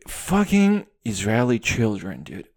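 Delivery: usual speaking voice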